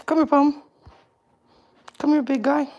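high, soft sing-song call to the dog; high pet call. Real, not acted. Owner coaxing her dog to come, high and soft. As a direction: coax the dog over in a lifted voice. Calling the dogs over.

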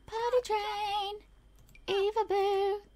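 high, drawn-out coo; cutesy high singsong; singsong baby talk. Pretend. Singsong baby talk to game toddler.

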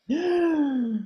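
high, drawn-out theatrical gasp; suspiro de espanto. Acted. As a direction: gasp in mock shock at the scoreboard. Reação teatral ao placar do jogo. (suspiro agudo de espanto teatral)

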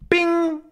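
very high pinging sound; ringing bing. Acted. Bullet hitting the fake shark, high ping. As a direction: ring it like a bullet off metal. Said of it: "it goes".

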